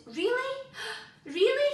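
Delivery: high, loud, rising Really; thrilled princess shouting as the dragon shows he can fly, high and loud; excited shout. A narrator's reading. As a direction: shout it with joy. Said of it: "shouted Meg".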